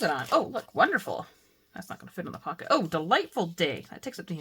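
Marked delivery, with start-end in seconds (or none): 1.7-2.7 muttered aside. Real, not acted. Disappointed aside.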